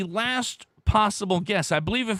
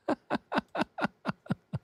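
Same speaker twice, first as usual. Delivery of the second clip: soft breathy wheezing laugh; real laugh; 9 semitones higher, 7 dB quieter than usual. Real, not acted. Host letting the rare moment sink in, wheezy laugh. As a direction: laugh it out quietly in a long string.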